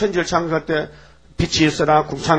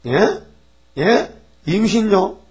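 high, rising incredulous questions; acted shock, pitch leaping up; startled disbelief; 6 semitones higher, much slower than usual. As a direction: react as if stunned by the news. Acting out Joseph hearing of pregnancy.

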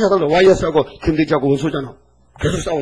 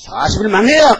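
loud prophet's cry of doom; loud street proclamation; proclaiming shout; 6 semitones higher, 6 dB louder than usual. Retold or imitated. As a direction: cry it out like a prophet warning a city. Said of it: "막 외쳐야 되는데".

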